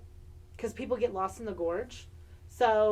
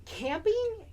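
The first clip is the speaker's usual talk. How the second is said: incredulous; 12 semitones higher than usual. Real, not acted. Incredulous at camping suggestion.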